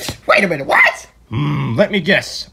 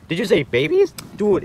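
normal talking voice

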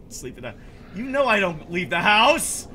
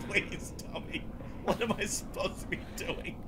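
whispered plea; 2 semitones lower, 12 dB quieter than usual. Pretend. Dad begging son for help.

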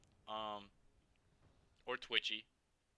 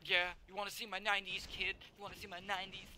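taunting; 8 semitones higher than usual. Acted. Taunting enemy in game.

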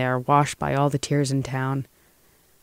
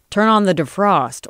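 higher, louder senatorial order; 5 semitones higher, 4 dB louder than usual. A narrator's reading. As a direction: give the driver a firm order. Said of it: "ordered the senator".

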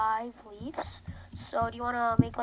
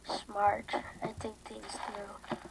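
smug whisper; 6 dB quieter, slower than usual. Real, not acted. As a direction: whisper it smugly to yourself.

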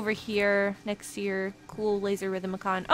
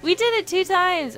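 excited cheer; 5 semitones higher, 6 dB louder than usual. Real, not acted. Scan finally works, celebrating.

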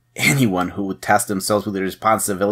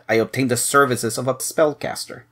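usual speaking voice

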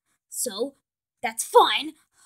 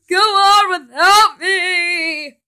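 slow, hammed-up wailing farewell; melodramatic dying cry; 6 semitones higher, 13 dB louder, much slower than usual. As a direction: overact a heroic last breath. Mock dying hero.

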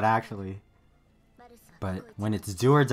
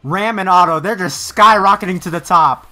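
loud, higher cheer for Otto; 6 semitones higher, 10 dB louder than usual. Real, not acted. Reactor cheering on Otto in the fight, loud. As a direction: yell like a fan in the stands.

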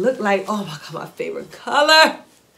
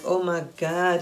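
normal talking voice

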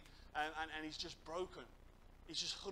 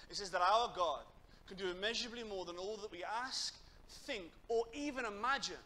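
raised, emphatic sermon voice; impassioned preaching; 4 semitones higher, 6 dB louder than usual. Acted. Preacher tells youth how a lad met God, impassioned. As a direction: drive the testimony home with fervour.

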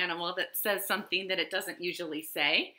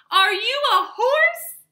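high, loud, drawn-out hopeful question; overjoyed; 11 dB louder than usual. A narrator's reading. Overjoyed cowboy asking a new creature, excited cry. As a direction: ask it loudly, bursting with excitement.

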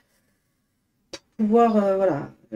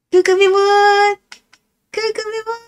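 squeaky pet greeting; 12 semitones higher, 8 dB louder than usual. Real, not acted. Woman greeting her cats on camera in a very high squeal. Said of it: "des voix débiles quand on parle à nos animaux".

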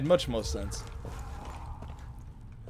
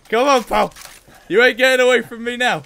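taunting pursuit; 7 semitones higher, 9 dB louder than usual. Real, not acted. Chasing an enemy in game.

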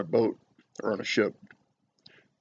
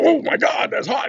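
high, sudden cry of pain at a hot spoon; startled yelp; 14 semitones higher, 3 dB louder than usual. Retold or imitated. Stranger burned by a hot spoon, high startled yelp. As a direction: yelp it like you just got burned. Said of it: "the person next to him would go".

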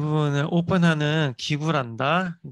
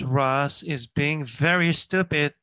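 very slow, every word clear; slow model reading; much slower than usual. Shown as a demonstration. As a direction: read it word by word for learners. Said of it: "teacher reading the line slowly".

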